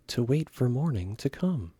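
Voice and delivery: usual speaking voice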